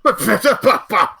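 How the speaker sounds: high, loud stammer of outrage; flustered, explosive stuttering; indignant sputtering. Acted. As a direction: sputter in disbelief, word by word. Priest sputters at order to heal.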